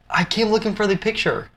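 high, pleading voice of the hero; imitating an exasperated man who crossed the world; earnest Walter. Walter explains, retold movie scene.

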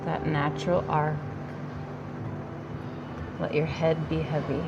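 normal talking voice

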